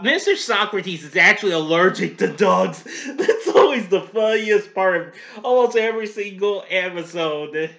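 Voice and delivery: loud, amused, laughing as you talk; real giggles breaking into loud talk; talking through laughter. Real, not acted. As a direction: crack up while explaining, voice jumping up. Cracking up after own impression.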